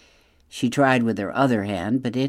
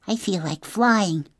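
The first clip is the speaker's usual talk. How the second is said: put-on high-pitched young bird voice; little creature with a high voice; little gosling; 8 semitones higher than usual. A narrator's reading. Gosling answers robot mother.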